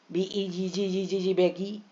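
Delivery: slow chant बीईजी जी जी जी जी बैगी, rising at the end; playful memory chant ending on बैगी; sing-song mnemonic. Shown as a demonstration. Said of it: "अपने मन से हम शब्द भी बना सकते हैं". (धीमा गुनगुनाता हुआ बैगी)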